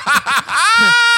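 high, shouted burst of laughter; loud shrieking laugh. Real, not acted. Co-host freed to curse, loud shrieking laughter. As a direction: let out a big high cackle. Outburst after permission to curse.

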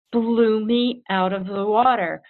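ordinary delivery

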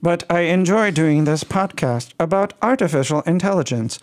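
slightly flat, stiff speech-synthesizer voice in a mild French tint; Stephen Hawking voice. Pretend. Host keeps the Hawking-in-French voice for the outro. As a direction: play a computer voice reading the outro, lightly. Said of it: "like Stephen Hawking speaking French".

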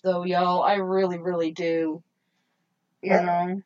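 ordinary talk

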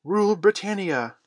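put-on high, loud squeaked boy's cheer. A narrator's reading. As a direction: squeak it out loudly like a small boy. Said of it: "squeaked Curly".

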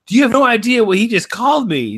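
high, loud indignant tattle; whiny tattling brother. Retold or imitated. Brother tattling to mom, high indignant whine.

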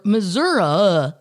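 "Missouri" drawn out slowly as mə-ZUR-uh; Missouri with -uh ending. Shown as a demonstration. Showing the cohost the southern "Missouri" slowly. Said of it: "Nobody north says Missouri".

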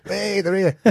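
half-volume cheer on spotting friends; mock cheer. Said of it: "you cheered".

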